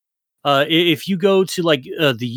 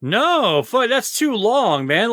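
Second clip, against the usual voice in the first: indignant; 8 semitones higher, slower than usual. Real, not acted. Arguing the bro code.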